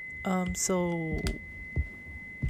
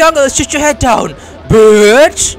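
loud, much higher angry shouting; furious yelling; 10 semitones higher, 14 dB louder than usual. Real, not acted. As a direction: yell back while shooting. Shooting back at insulting enemy.

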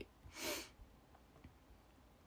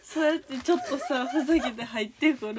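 loud, high, exasperated protest through laughter; laughing scolding; 11 semitones higher, 8 dB louder than usual. Real, not acted. Streamer telling off a prankster who walked in, laughing scolding. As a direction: scold them while cracking up.

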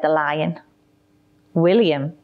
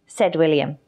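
usual speaking voice

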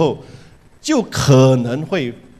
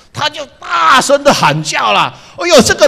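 higher, louder storytelling burst; excited telling; 10 semitones higher, 6 dB louder than usual. A narrator's reading. Preacher telling the congregation the horse screamed, loud and higher. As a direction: build the drama of the horse's scream.

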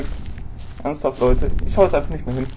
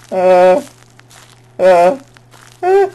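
startled yelp; 6 dB louder, much slower than usual. Real, not acted. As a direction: jump and yelp in fright. Startled in horror game.

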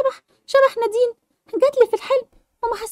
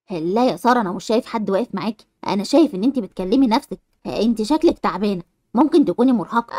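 much lower, a concerned grown man; man's voice; 13 semitones lower than usual. Acted. Man asking Sara why she talks to herself, low concerned voice. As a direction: speak low as a worried neighbour checking on Sara.

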